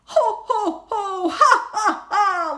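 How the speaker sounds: jolly Christmas laugh; Santa laughing. Acted. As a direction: laugh out a surprised ho ho ho. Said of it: "laughed Santa".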